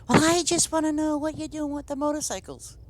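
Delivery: high, mocking imitation of a nosy elderly woman; mocking her tone. Teller mimics Pat demanding news of the motorcycles, high mocking. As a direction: pitch her demand way up in mockery. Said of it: "that was her tone".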